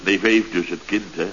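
usual speaking voice

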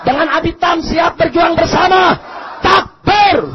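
loud, high crowd-rousing call; rallying shout. Real, not acted. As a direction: shout it to rouse the whole crowd. Leading a rally takbir call. (seruan takbir yang lantang)

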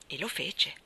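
ordinary delivery, nothing marked

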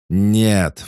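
loud, high, drawn-out roar; roared refusal. A narrator's reading. The inner voice protests his charge, roaring. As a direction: roar it out with full force. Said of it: "взревел голос".

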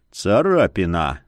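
low gruff grumble, brushing it off; grumbled. Acted. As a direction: mutter it curtly. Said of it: "буркнул Яромир".

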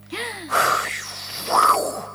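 sharp high gasp of wonder; high breathy gasp. Acted. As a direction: gasp as if seeing a miracle. Healer amazed at the cure. (jadeo agudo de asombro)

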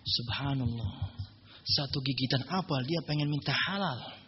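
a hint of a slow, low old man, amazed; marvelling old owner. Orchard owner marvels at the young man, slow and low. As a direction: slowly savour his amazement at the honest guest.

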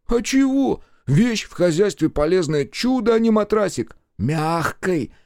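high, smug village lad; pleased looter. A narrator's reading. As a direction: defend the prize like a proud scavenger. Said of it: "крайне довольный собой".

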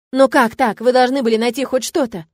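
громкий возмущённый возглас; выкрикни с напором; восклицая. A narrator's reading. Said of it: "воскликнула я". (громко воскликнула)

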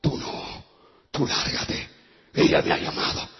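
hoarse, voiceless demon growl. Retold or imitated. Demon orders the pastor out, hoarse demon voice. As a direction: rasp it out as the demon. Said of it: "me mira el demonio y me dice".

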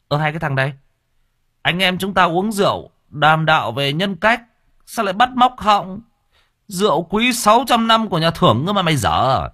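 put-on high, loud huffy old drinker; indignant protesting uncle. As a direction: scoff at the boy, offended. (ông chú phẫn nộ, giọng cao và to)

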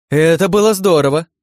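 high, loud delighted exclamation; bursting with excitement; thrilled. A narrator's reading. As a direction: cheer the stunt on excitedly. Onlooker thrilled by the stunt.

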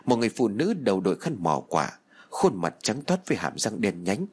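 usual speaking voice

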